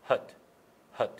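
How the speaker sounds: ordinary talk, nothing marked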